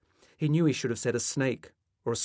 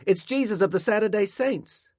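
loud, high call carried to the end; 12 semitones higher, 7 dB louder than usual. A narrator's reading. Boy reporting the visitor's name to his mother, calling out. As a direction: announce it loudly across the house. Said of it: "as he called to his mother".

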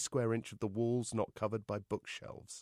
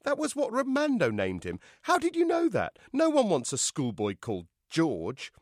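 appalled objection; 8 semitones higher, 4 dB louder than usual. A narrator's reading. Mother objects to the name.

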